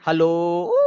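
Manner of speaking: slow, heavy, drawn-out hello; low-pitched hello. Shown as a demonstration. Teacher showing students a low, heavy hello. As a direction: drop into a thick, slow voice for hello. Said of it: "मेरी pitch कम हो गई".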